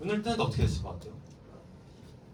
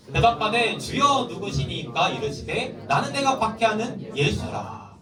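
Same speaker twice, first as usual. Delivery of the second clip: slow, higher scripture read-along; loud group reading; 9 semitones higher, 3 dB louder, slower than usual. Real, not acted. Teacher leading the children reading the verse aloud together, slow. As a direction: read aloud slowly with the class. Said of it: "큰 소리로 읽어보겠습니다".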